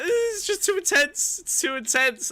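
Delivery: high, slow, overwhelmed whimper; imitating crying worker. Retelling the sub-manager breaking down, high and slow. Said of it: "She's like".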